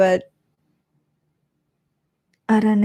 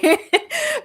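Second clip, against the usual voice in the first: short high laugh; real, amused high laughter; high giggle. Real, not acted. Laughing at the cat.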